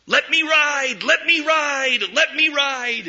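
put-on high, loud child's shout; a boy shouting from the riverbank to the paddle boat. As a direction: yell it to the passing boat, pleading. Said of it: "began to shout at the top of his lungs".

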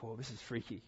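soft spooked aside; quiet aside. Retold or imitated. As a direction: breathe it to yourself, spooked. Inner reaction.

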